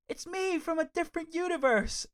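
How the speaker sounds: very high-pitched imitation of a character; excited mock reveal. Retelling a comic character's reveal, mock high voice. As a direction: put on a squeaky high voice for the big reveal.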